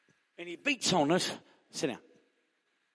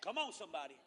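normal talking voice

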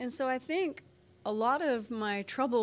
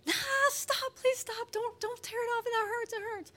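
put-on much higher, pleading little girl; whining child; 13 semitones higher than usual. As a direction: play the toddler begging mom to stop. Child pleading as Band-Aid pulled.

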